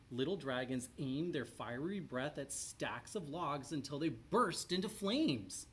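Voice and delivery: ordinary delivery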